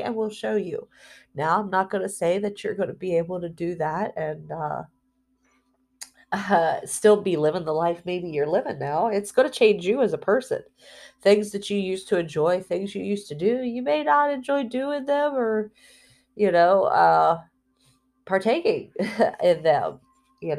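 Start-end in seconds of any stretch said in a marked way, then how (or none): none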